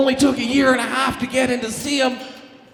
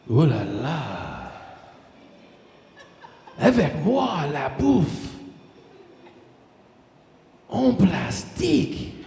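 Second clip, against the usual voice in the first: slow, low hammed-up French accent; 8 semitones lower, 3 dB quieter, slower than usual. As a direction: purr it like a cheesy Frenchman. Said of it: "he would be like".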